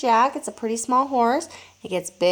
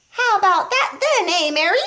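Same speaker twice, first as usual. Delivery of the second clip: put-on high, loud cackling old grandma shout; 9 semitones higher, 4 dB louder than usual. A narrator's reading. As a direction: crow it with a laugh. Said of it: "Grandma shouted".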